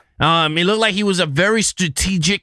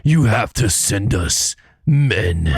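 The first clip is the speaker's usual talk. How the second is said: very low, slow action-movie hero; 9 semitones lower, slower than usual. Retold or imitated. Co-host mocking the lead actor's faked accent, action movie voice. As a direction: drop deep and drag it out like an action star. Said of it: "he had a movie, action movie voice going".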